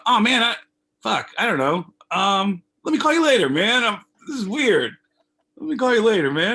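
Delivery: high, loud retold awkwardness; weirded out. Caller begs off from a friend on the toilet, weirded out. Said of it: "I just felt weird".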